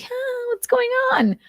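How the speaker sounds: very high, puzzled dog voice; confused dog. Pretend. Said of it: "Dog's like".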